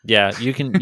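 ordinary talk, nothing marked

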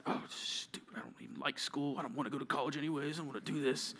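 soft, sulky muttering; grumbling. Acted. Kid told to study instead of visiting a friend, grumbling quietly. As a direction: grumble it half under your breath. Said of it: "that's grumbling".

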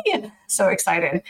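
ordinary delivery

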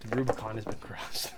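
hushed mock-solemn whisper; a whispered mock-epic pronouncement; dramatic whisper. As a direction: breathe it like an ominous omen. Dramatic echo of friend's line.

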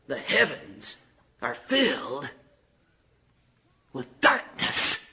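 breathy near-whisper, slow; hushed. Real, not acted. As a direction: let the word fade into darkness. Hushed description of darkness.

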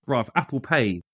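ordinary talk

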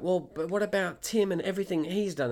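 put-on voice of a displeased customer; unhappy protest. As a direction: mimic her complaining objection. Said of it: "she wasn't very happy with this".

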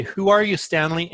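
high, loud, emphatic question; retold challenge. Friend prompting Stanley over the coming days, retold emphatic challenge. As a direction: imitate a coaxing challenge, lifted and punchy.

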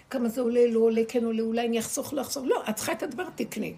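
loud, insistent imitation of dithering; mimicked loud haggling with oneself; mock fretting. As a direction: rattle off the back-and-forth like a worried calculator. Mocks fretful inner money calculating.

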